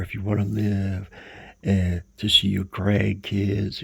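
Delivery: loud, pressing doctor's plea; urgent plea. Retold or imitated. Doctors urging a heart patient to quit, loudly. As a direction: push hard so he listens.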